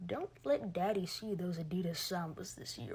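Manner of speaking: low, soft mock dad warning; a hint of a menacing father; mock daddy threat. Mocking a threatening post.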